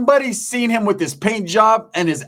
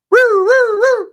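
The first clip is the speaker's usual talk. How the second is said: high, loud repeated siren whoops; siren wail; 5 dB louder than usual. Retold or imitated. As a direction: imitate a police siren wailing. Imitates police siren pulling over.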